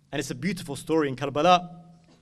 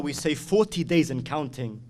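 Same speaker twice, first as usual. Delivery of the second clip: ordinary delivery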